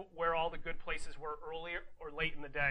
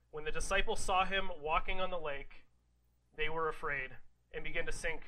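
ordinary delivery

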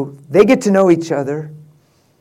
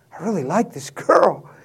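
ordinary talk, nothing marked

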